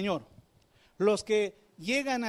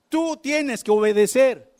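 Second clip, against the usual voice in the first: forceful command; 4 semitones higher, 10 dB louder than usual. Acted. Preacher commands obedience.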